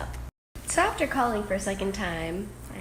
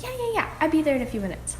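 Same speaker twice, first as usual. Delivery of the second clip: breezy agreement; 7 semitones higher, much faster than usual. Retold or imitated. Quoting neighbor's breezy yes.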